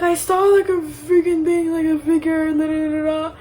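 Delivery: put-on high, mildly frantic child's account; panicked retelling. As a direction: imitate upset babbling to mom. Child explains the scare to mom.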